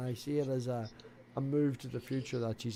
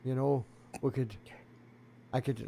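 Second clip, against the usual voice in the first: ordinary talk, nothing marked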